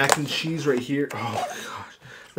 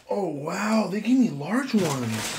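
surprised; 7 semitones higher, slower than usual. Real, not acted. Big portions revealed.

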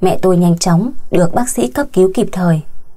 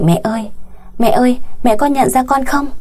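mildly urgent, higher-pitched plea; urgent call; 5 semitones higher than usual. A narrator's reading. Daughter-in-law calls to mother lying in hospital, mildly urgent. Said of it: "Tôi gấp gáp gọi".

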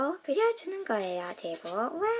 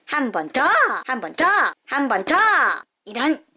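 louder chant of "one more time"; cheering; 7 dB louder than usual. Real, not acted. Host cheering her flicking game, loud and eager. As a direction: urge yourself on each flick.

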